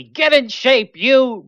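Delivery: loud, high mock-sergeant shout; playful drill-sergeant yell. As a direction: bark it like a drill sergeant ordering a recruit. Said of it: "imagining the drill sergeant yelling".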